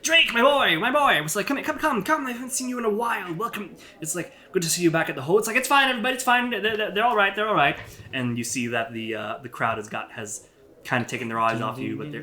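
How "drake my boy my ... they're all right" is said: put-on high old man's voice, fussy and delighted; an old advisor welcoming Drake back, high and fussy; old elf voice. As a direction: greet him like a doting old advisor. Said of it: "he's like".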